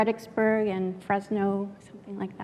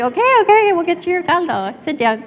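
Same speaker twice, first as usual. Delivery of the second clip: placating dad; 8 semitones higher, 7 dB louder than usual. Retold or imitated. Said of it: "my dad's like".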